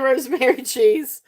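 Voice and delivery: ordinary delivery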